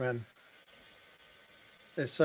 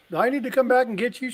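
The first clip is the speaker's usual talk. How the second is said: voicing himself; 10 semitones higher, faster than usual. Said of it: "I was like".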